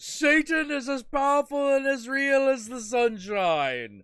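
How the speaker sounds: mock outraged Satanist; indignant outrage. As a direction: protest hotly, climbing to a yell. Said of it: "this one guy going".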